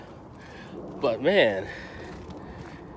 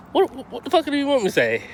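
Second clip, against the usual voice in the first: exasperated; 11 semitones higher than usual. Real, not acted. Defending his liking of the movie.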